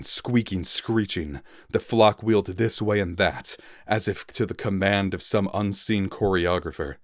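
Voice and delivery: ordinary delivery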